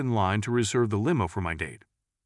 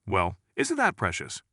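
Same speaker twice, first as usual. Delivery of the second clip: sweet Southern lady; slower than usual. Acted. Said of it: "the Southern lady commented".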